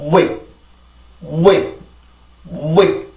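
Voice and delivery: "웨이" with a brief rounded w shooting straight into the vowel; don't linger on the w in "위", move straight up; short quick glide. Shown as a demonstration. Teacher models the W glide for learners, short and quick. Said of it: "절대 너무 오래 끌지 않고".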